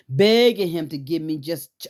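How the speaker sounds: normal talking voice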